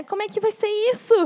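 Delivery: high, quick, dismayed question; retold desperation. Parents bewildered by the surgery, retold desperation. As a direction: ask it fast and high, as if at a loss. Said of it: "A gente ficou desesperado".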